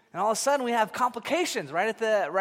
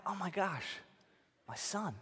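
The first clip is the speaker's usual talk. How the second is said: much softer, hushed wonder; tender awe; 12 dB quieter than usual. Retold or imitated. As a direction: breathe it out over the newborn. Father meeting newborn son.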